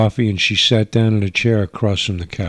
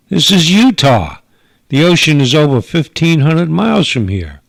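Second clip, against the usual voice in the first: louder and higher, incredulous; exasperated outburst; 7 semitones higher, 5 dB louder than usual. Acted. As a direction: blow up at her nonsense. Agent exasperated at teen.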